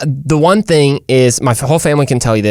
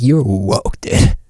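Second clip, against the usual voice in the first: mock British accent; 5 semitones lower, much slower than usual. Shown as a demonstration. Host demonstrating woke to listeners, low mock British accent. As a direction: lean on a fake British "woke". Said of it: "that's the way you gotta say it".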